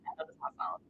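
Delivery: whispered to herself under the music; whispered aside. Real, not acted. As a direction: breathe it out as a private aside.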